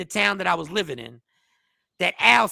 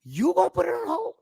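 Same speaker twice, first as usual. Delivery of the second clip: incredulous; 10 semitones higher than usual. Real, not acted. Disbelief at Netflix shelving the film.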